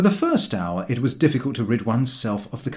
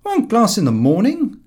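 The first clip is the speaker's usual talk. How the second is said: higher and louder, hearty; a jolly beer-lover lays down his rule; George's voice; 11 semitones higher, 7 dB louder than usual. A narrator's reading. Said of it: "said George".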